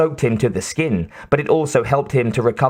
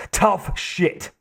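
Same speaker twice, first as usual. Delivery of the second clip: much higher, sharp snap, softer; snapped; 7 semitones higher, 3 dB quieter than usual. A narrator's reading. Harry turning on Quaid who wants to leave, sharp snap. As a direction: cut him off harshly. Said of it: "Harry snapped".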